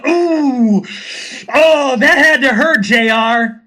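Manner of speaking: high, drawn-out "ooh" at a brutal hit; hammed-up ringside commentator reacting to a blow; wrestling commentator wincing.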